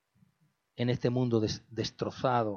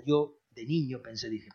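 ordinary talk, nothing marked

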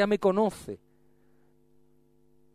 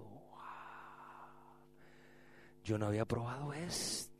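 soft, low wonder; hushed amazement at the taste; quiet awe; 6 semitones lower, 18 dB quieter than usual. Retold or imitated. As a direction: murmur it like a secret delight. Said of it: "yo no lo había probado esto".

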